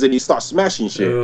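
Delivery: normal talking voice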